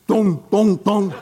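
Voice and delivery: sing-song dong dong of a big knocker; playful imitated door chime; doorbell imitation.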